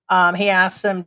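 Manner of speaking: usual speaking voice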